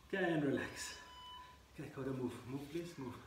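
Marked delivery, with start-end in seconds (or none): none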